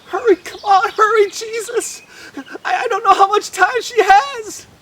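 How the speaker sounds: high, loud hurrying; urgent. A narrator's reading. Father rushes Jesus toward his dying daughter, high and urgent. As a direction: push him on, anxious. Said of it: "Jairus is saying".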